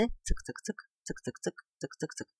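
very soft, quick ticking; train imitation. Grandfather reluctantly playing along, hushed train clicks. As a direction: tick away under your breath like a little train. Grudgingly keeps up train noises.

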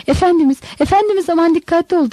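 narrated women's anxious cry; alarmed cry. A narrator's reading. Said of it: "diye bağırdı Marta ile Maria".

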